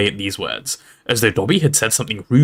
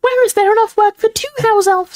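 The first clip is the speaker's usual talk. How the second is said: very high, loud elf squeak; quoting house elf; 5 dB louder than usual. A narrator's reading. Dobby retells the other elf's question, squeaky voice.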